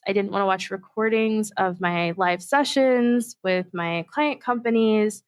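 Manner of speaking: ordinary delivery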